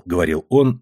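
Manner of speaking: plain reading voice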